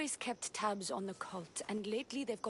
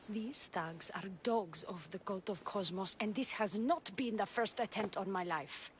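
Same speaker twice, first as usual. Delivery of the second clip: ordinary delivery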